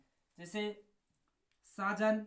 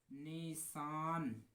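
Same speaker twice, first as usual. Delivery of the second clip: slow, stretched syllables; drawn-out name; 3 semitones lower, 4 dB quieter, much slower than usual. Shown as a demonstration. Teacher spelling an instrument name for students, slowly drawn out. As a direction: stretch each sound so pupils catch it. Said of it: "न से".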